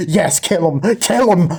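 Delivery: high, loud bloodthirsty cheer; mock-savage rooting from the audience; gleeful cheering on. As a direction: egg the monster on, pitch climbing. Viewer gleefully cheering the monster on.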